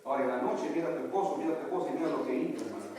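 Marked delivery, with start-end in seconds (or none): none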